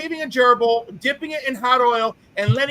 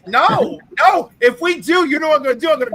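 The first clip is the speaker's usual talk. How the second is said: loud refusal; 4 semitones higher, 4 dB louder than usual. Real, not acted. Refusing co-host's demand loudly.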